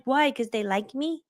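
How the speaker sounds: soft, innocent little question; naive little voice. Retold or imitated. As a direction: play dumb and sweet. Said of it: "I said it like that".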